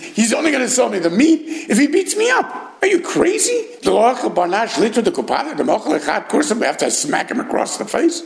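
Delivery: high, exasperated disbelief at an insane custom; high-pitched indignant what-is-this-place rant; incredulous outrage. Acted. Rebbe Zira outraged at butcher.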